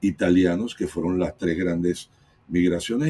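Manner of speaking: ordinary talk, nothing marked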